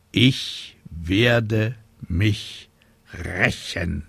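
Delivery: very slow "Ich werde mich rächen", every syllable weighted; syllable-by-syllable stress. A narrator's reading. Grim witch vowing revenge, "Ich werde mich rächen" stressed syllable by syllable. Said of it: "Silbe für Silbe betonend".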